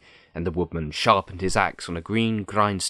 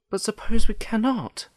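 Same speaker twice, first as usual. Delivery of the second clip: put-on very high, anxious little-girl voice; worried girl; 5 dB louder than usual. Dorothy questions the Lion's plan, worried. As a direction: pitch it right up, doubtful.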